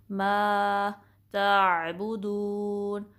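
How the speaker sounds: मा held for two counts, slow and melodic; stretched madd. Shown as a demonstration. Teacher demonstrating the madd in मा ताबुदून, slowly. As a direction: stretch मा, then a light ता. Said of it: "टू count stretch करेंगे".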